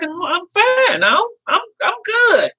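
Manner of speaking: high-pitched, drawn-out reassurance; protesting in a high voice; retold insistence. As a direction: act out insisting you're okay. Retold insisting she is fine.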